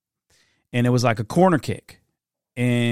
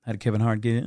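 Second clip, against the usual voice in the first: normal talking voice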